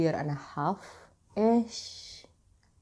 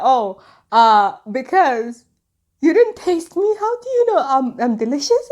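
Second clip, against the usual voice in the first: high, loud mock-indignant quote; retold flustered protest; 7 semitones higher, 8 dB louder than usual. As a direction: act out a scandalised friend, pitched up. Friend's embarrassed protest, retold.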